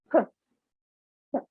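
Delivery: p with a strong puff of air; English aspirated p. Shown as a demonstration. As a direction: blow the paper with p. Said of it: "we will pronounce the sound".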